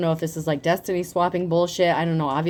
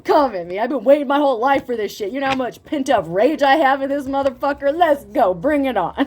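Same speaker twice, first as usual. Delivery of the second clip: egging on a fight; hyped challenge; 6 semitones higher than usual. Acted. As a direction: bring it energy. Hyped-up challenge, pent-up rage.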